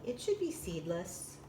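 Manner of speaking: ordinary delivery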